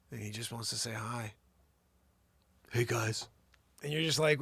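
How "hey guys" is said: lightly imitated laid-back actor; Keanu Reeves. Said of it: "sometimes Keanu Reeves stops by".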